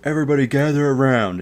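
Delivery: high, loud mock yelling; losing her mind. Teacher halts the game over a muffin, yelling frantically. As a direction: imitate a frantic teacher ordering everyone about. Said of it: "she was losing her mind".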